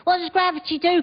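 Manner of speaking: put-on high, curious child's voice; child's question. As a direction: ask it like a small kid. Child voiced in parent scene.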